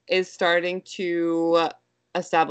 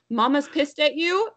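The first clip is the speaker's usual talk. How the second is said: put-on high, rising child's question; questioning toddler; 8 semitones higher than usual. Toddler asks whether mom is mad, high rising question. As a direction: imitate a toddler asking innocently. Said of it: "She's like".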